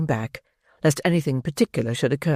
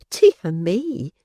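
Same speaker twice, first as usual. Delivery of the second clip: higher, startled prim exclamation; a matron taken aback; exclaiming; 4 semitones higher than usual. Acted. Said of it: "exclaimed the matron".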